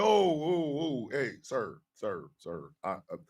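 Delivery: low, hushed, halting plea; soft, low, hesitant appeal; placating the sergeant. Retold or imitated. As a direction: gently try to settle a screaming superior. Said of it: "I was like".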